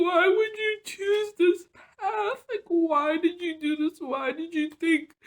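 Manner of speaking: high, loud, tearful reproach; angry with herself. Retold or imitated. Woman reliving berating herself on the floor, anguished anger. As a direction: wail the retold questions, voice breaking. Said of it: "getting so angry with myself".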